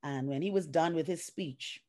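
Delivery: ordinary delivery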